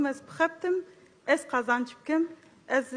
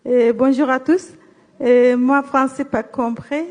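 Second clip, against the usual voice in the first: louder, right up at the microphone; close to the mic; 11 dB louder than usual. Real, not acted. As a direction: lean in and speak up. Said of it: "Parlez plus près du micro".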